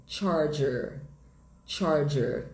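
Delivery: American "charger" with both Rs sounded; slow, clear American teacher demo of "charger"; sotaque americano. Shown as a demonstration. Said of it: "Em inglês americano". (sotaque americano, com os dois R)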